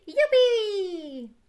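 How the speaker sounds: high, loud happy cheer; little sheep whooping; joyful whoop. Acted. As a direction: whoop it high, stretching the end.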